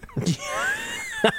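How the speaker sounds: a sudden high yelping laugh; shrieking laugh. Real, not acted. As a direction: burst out in a shrill cackle. Bursting into laughter mid-rant.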